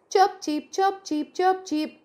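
high, chirpy little bird calls; chirping bird. Acted. Storyteller voicing the little bird greeting the snake, high chirps.

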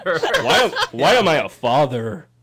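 mock the drawn-out end of "father"; slurred ending imitation. Host imitating the producer's slurred "father". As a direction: loudly stretch the final r of "father". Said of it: "slurring the end of his father".

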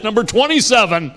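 high-pitched mock list announcement; preacher calling a list. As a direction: imitate a teacher calling out the next point. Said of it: "he's just going through this list".

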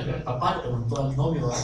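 ordinary delivery

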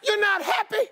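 very high imitation of an offended wife; hurt wife. Wife hurt by husband's reaction.

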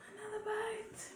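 breathy whispered repeat of Another bite. Pretend.

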